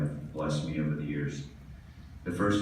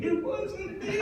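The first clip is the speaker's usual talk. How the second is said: high-pitched yell held back in volume; mock yell under breath. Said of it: "under my breath, I am yelling".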